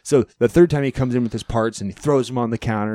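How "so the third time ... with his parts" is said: normal talking voice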